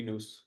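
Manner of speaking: "Nous" with a long oo; Greek-style "Nous". Shown as a demonstration. Co-host settling how the name goes, "Nous" said with an oo. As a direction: say "Nous" to rhyme with goose. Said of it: "In Greek, it would be".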